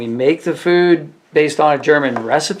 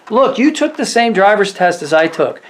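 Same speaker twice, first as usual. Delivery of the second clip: lecturing retort; 7 semitones higher, 4 dB louder than usual. Retold or imitated. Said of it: "I said".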